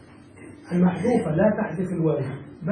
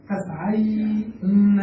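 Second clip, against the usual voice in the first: slow model word; much slower than usual. Shown as a demonstration. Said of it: "النون لتوالي الأمثال".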